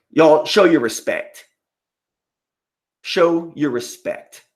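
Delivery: drawn out, slow call for respect; slow commanding demand. Acted. Streamer ordering chat to honor the champion, slow. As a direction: make the room bow.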